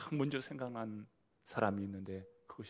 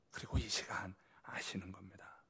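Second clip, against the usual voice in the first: whisper; 3 semitones lower, 5 dB quieter than usual. Real, not acted. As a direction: drop to a breathy hush. Preacher drops to a whisper. (속삭이듯)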